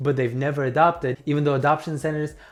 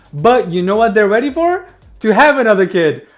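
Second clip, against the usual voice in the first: loud sarcastic jab; 5 semitones higher, 8 dB louder than usual. Acted. Sarcastic punchline about the couple.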